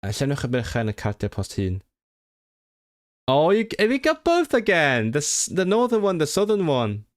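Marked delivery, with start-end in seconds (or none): 3.3-7.0 exclaiming. Real, not acted. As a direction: cry out in high-pitched disbelief. Exasperated at two dialect forms.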